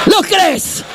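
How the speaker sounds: high shouted question; preaching shout. Acted. Preacher shouting a question to the congregation. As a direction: call it out to the crowd.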